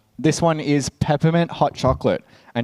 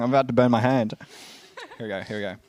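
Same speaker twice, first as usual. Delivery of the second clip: normal talking voice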